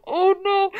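high, laughing cry of delight. Real, not acted. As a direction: squeal it through laughter. Laughing at Boston joke.

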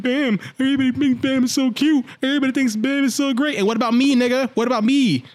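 high, indignant imitation of envy; mock jealous rant. Jealous girl complains about Bam, high mocking rant.

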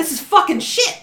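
mock rant. Mock rant about frustrations.

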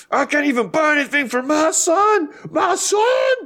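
very high hammed-up scream, climbing to the last words; mock screaming. Imitating him arguing about his son, screaming at full pitch. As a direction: scream it like an outraged man, exaggerated. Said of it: "Like, just screaming".

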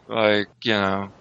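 ordinary talk

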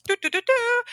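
high sung warning "doo, doo, doo, doo"; imitated alarm. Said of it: "a little red flag goes".

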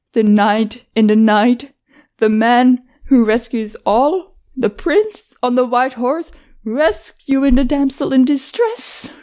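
bitter mocking. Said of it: "came out sounding bitter and annoyed".

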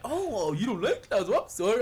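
clown. Retold or imitated. Clown answers the angry kid, exaggerated mock apology. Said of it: "And he's like".

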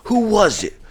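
high-pitched imitation of the boss; demanding boss. Boss asking which driver slept, demanding.

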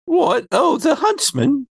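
high, swooping character voice; Phoebe. Acted. Phoebe dismisses the question in her high affected voice. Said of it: "my Phoebe voice is this".

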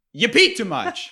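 high, slow, teasing accusation with a laugh; mock scolding. Scolding the partner for peeing too much, high and slow. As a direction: drag it out high like a playful telling-off.

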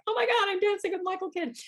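high, breathless thrill; squealing with disbelief; starstruck gush. Retold or imitated. Starstruck memory of dancing.

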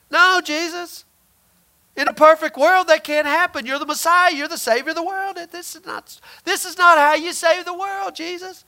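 a hint of an indignant disciple scolding his teacher; Peter rebuking. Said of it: "He rebukes Jesus".

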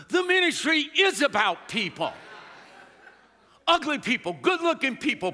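full-force preacher's cry; preaching shout. Real, not acted. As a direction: hammer each kind of people home.

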